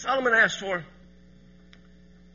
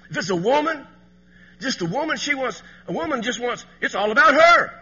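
loud, high preacher building to a shout; preaching at full force; 9 semitones higher, 9 dB louder than usual. Acted. Preacher building to a shout for the men in church. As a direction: hammer the point home to the congregation.

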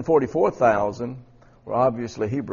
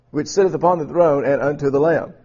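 louder, higher scripture reading; emphatic corrected reading; 4 semitones higher, 8 dB louder than usual. Real, not acted. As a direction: reread the verse firmly, projecting it to the room. Rereads verse with corrected word.

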